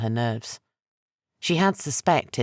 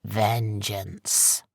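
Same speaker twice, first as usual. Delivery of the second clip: rasped; 3 semitones lower than usual. A narrator's reading. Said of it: "he rasped".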